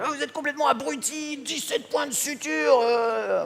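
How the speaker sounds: high, strained scolding voice; angry fireman. Retold or imitated. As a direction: bark at him like an outraged fireman. Pompier scolds the speaker.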